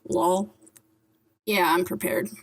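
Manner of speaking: usual speaking voice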